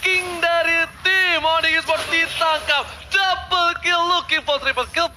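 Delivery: hyped arena announcer call; fighting game announcer. Real, not acted. Play-by-play of double kill.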